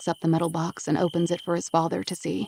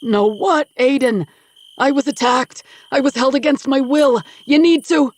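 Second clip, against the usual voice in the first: high, loud indignant outcry; 8 semitones higher, 9 dB louder than usual. Acted. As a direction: insist you are the victim. Father protests he's the victim.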